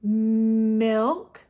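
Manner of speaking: milk with a long hummed "mmm" before the vowel; drawn-out M. Shown as a demonstration. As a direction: hold the M in "milk", rising at the end. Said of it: "and make the M sound?".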